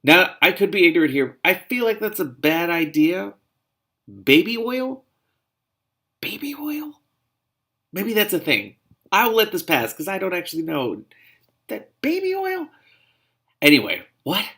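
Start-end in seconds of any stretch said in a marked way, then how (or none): 0.0-5.0 high, loud, incredulous; can't believe what she's reading; baffled disbelief. Real, not acted. Reader questioning the book's baby oil idea. 6.2-7.0 high voiceless whisper of disbelief; stunned whisper. Real, not acted. Reader whispering the phrase in disbelief. 11.7-12.7 very high incredulous squeak; squeaky disbelief. Real, not acted. Reader squeaking at the book's baby oil.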